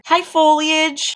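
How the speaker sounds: high, slow sing-song hello; cutesy greeting to the leaves. Real, not acted. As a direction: coo a hello to the plants. Greeting plants sweetly.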